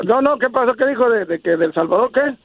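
ordinary talk, nothing marked

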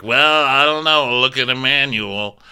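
put-on lazy old sailor brushing it off; gruff old captain. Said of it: "He's like".

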